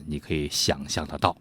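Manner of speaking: ordinary talk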